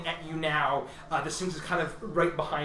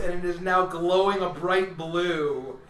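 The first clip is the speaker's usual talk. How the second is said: ordinary talk, nothing marked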